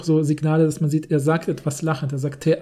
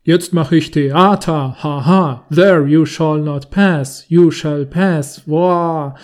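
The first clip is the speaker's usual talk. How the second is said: deep, slow, hammy stage voice; mock a pompous actor in a deep voice; 6 dB louder, much slower than usual. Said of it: "mit tiefer Stimme imitierend".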